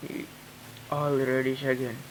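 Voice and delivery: ordinary delivery, nothing marked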